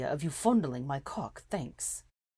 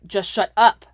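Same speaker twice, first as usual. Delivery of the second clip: much louder angry snap; snapped; 4 semitones higher, 16 dB louder than usual. A narrator's reading. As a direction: bark it at her. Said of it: "he snapped".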